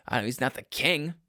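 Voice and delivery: normal talking voice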